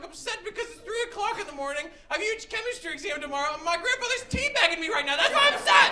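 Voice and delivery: high-pitched rant building to a yell; exasperated outburst. Retold or imitated. Upset grandson at three a.m., building exasperated yell.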